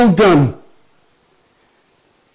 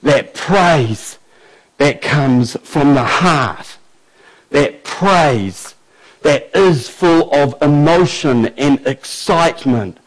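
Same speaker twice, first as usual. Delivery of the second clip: low, soft, intimate sermon voice; quiet earnest preaching; 10 semitones lower, 5 dB quieter than usual. Acted. Preacher challenging the church about proper praise, low and hushed. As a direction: draw the congregation in, hushed and sincere.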